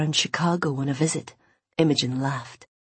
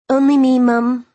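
higher, louder call to another room; 8 semitones higher, 10 dB louder than usual. Acted. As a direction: call it through the house. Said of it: "Imogen called into the kitchen".

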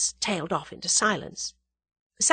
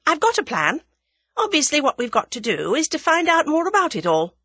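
higher, louder, assured female voice; young woman character, assured; 10 semitones higher, 6 dB louder than usual. Acted. Young woman announcing her plan to her friend, assured.

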